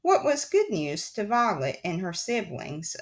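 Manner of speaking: usual speaking voice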